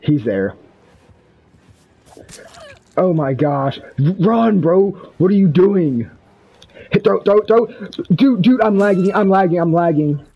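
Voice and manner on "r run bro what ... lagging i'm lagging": hyped gamer bro. Real, not acted.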